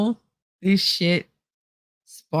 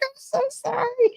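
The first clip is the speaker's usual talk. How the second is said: tiny, very high-pitched apology; mock-meek apology; 3 dB quieter than usual. Apologizing to a friend for singing during the game, mock-meek. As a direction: squeak out a playful sorry.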